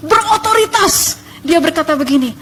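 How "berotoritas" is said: high, stretched emphatic word; berotoritas. Shown as a demonstration. Demonstrates the authoritative voice. (tegas, nada tinggi)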